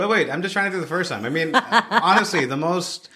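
high, laughing through the words. Real, not acted. Host asks about first meeting, laughing reply. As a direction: giggle while searching memory. Laughs while trying to recall.

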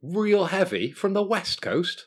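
high, slow, drawn-out hype DJ voice. Acted. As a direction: announce it like a radio hype man. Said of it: "like I'm [name]".

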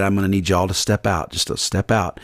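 low, quiet and unhurried, clearing the room; calm Jesus voice. Retold or imitated. Jesus calmly asking mourners to step out, low and quiet. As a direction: softly ask everyone to leave. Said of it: "He said".

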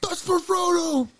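very high, slow squeak; squeaky high voice. Acted. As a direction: squeak it out slowly. High voice after scream.

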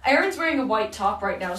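ordinary talk

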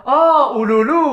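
loud, high "oh!" of realisation; sudden recognition. Retold or imitated. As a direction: act out the colleague finally getting it. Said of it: "他说".

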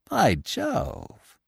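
dry sarcastic exclamation; mock surprise, heavy irony. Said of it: "said Lupin sarcastically".

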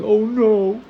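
high, whiny voice, far above normal; hammed-up spoiled moaning; mock spoiled whine. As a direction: whine like a pampered complainer over lost steak. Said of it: "We think, like".

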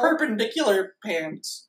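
high cartoon voice near laughter; Sponge Tower. Sponge finishing his mock name, high cartoon voice. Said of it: "I'm Sponge Tower".

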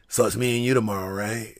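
very soft, low, quick murmur; Moxley's real talk. Retold or imitated. Wrestler checking tomorrow's match with opponent, low imitated murmur. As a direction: imitate him, low and under the breath. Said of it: "That's how he really talk".

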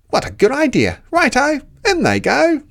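high, loud, cheeky Dingo; a greedy dog egging the others on. Acted. Dingo cheers Platypus tipping mud in.

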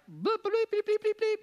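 very high squeaky bleeps standing in for swear words; mock censor bleeps. Youth pastor bleeping out the F-bombs he used to say. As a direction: bleep out your own cussing like a TV censor. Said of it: "it was like F-bombs".

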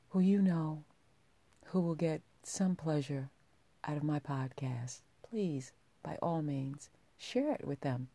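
ordinary talk, nothing marked

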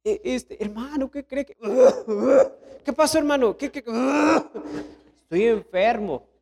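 badly faked ill voice; feigned sickness. Churchgoer excusing himself to route captain, feigned sickness. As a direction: play an excuse-maker pretending to be unwell. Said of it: "Ni está enfermo".

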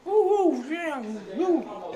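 high loud whooping; intro cheer. Pretend. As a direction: whoop like a show opening. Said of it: "É o barulho da abertura". (vinheta animada de abertura)

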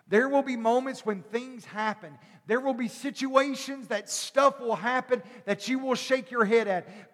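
ordinary talk

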